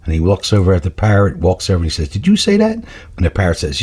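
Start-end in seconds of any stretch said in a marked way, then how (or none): 2.1-2.8 high-pitched joke-teller's disbelief; incredulous question. Acted. Burglar questions parrot in joke.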